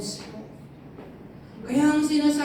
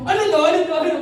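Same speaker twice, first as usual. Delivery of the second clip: loud, higher mock of his angry question; imitating angry dad; 5 semitones higher, 6 dB louder, much faster than usual. Son mimicking his father's outburst, mock angry shout. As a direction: throw his angry words back at him. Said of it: "Ginaya ko siya".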